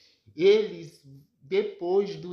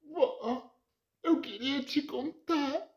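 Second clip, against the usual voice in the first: higher, tearful plea to grandma; crying; 8 semitones higher, 3 dB quieter than usual. Acted. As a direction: hold back tears while confessing. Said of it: "Sandra chora e diz". (neta chorando, falando mais aguda com a avó)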